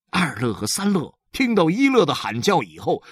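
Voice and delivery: ordinary delivery, nothing marked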